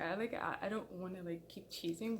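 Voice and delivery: normal talking voice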